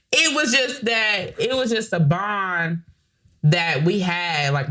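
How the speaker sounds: normal talking voice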